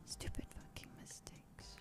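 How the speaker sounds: a bitter whisper under the breath; whispered self-reproach. Real, not acted. Whispering curse at herself while confessing. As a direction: hiss it at yourself in disgust.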